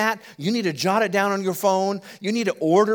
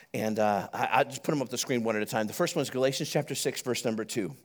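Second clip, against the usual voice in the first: usual speaking voice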